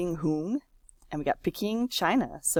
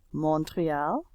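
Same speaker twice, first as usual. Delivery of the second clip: ordinary delivery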